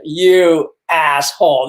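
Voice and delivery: very slow, drawn-out mock outrage; angry but relieved. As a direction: stretch each word as the pranked friend catching on. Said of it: "angry but, and relieved and laughing".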